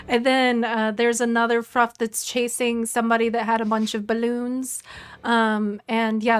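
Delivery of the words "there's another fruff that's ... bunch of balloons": usual speaking voice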